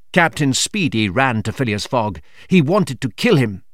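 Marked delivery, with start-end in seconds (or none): none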